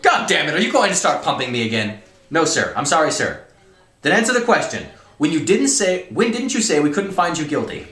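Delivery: loud, high-pitched heated interrogation; dramatic story reading. Acted. Colonel grilling cadets at trial, loud and exasperated. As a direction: act out a furious colonel and protesting cadets at full volume.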